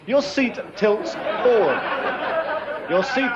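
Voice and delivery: slow, careful words through foam; mouth full. Real, not acted. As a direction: say it slowly around the mouthful. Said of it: "it's not easy to talk with a mouthful".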